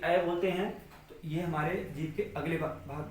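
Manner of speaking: usual speaking voice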